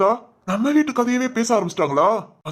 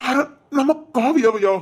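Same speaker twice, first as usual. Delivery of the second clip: shocked stammer; 4 semitones higher than usual. Acted. Overhears shocking gossip.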